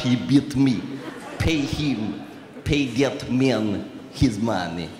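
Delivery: low, slow Russian mobster voice; Teddy KGB Russian accent. Retold or imitated. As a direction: drag out "pay that man his money" in a thick Russian accent. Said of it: "I do this character".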